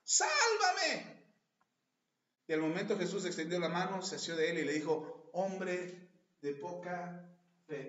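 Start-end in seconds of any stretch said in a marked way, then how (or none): 0.0-1.2 high, loud cry for help. Acted. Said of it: "dio voces diciendo". 5.3-7.9 slow rebuke. Acted. Jesus rebuking Peter.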